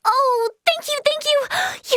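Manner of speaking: high, gushing gratitude; overjoyed thanks. Acted. Thanking someone for a precious chance, overjoyed. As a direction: squeal your thanks, pitch way up.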